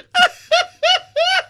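high laughing shriek; a real cackling shriek of laughter. Real, not acted. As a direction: laugh up high after the whoop. Laughing after the whoop.

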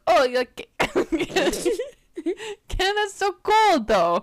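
high, loud mock New Jersey, giggling; thick New Jersey accent. Host quoting the guy on Canada's cold, mock New Jersey accent. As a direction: push the Jersey impression as it cracks. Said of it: "with the thickest New Jersey accent".

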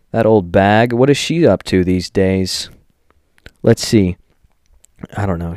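usual speaking voice

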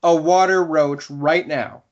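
high, slow, insistent mock plea; drawn-out imitation of a needy villager; pleading villager.

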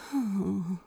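a quiet, escaping sigh; soft sigh. Acted. Crowley sighs under the starry sky, soft and quiet. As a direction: let a soft sigh slip out. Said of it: "couldn't stop the sigh from escaping him".

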